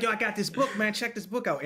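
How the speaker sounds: high, eager pitch; excited. Retold or imitated. Buddy hyping up a book from his cell. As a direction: hype up the book. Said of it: "And he's like".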